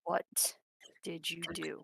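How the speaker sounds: hushed, deliberate accusation; quiet confrontation. Acted. Alyssa confronts Timbers about what he did, quietly accusing. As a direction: ask it very softly, with pauses.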